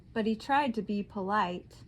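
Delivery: usual speaking voice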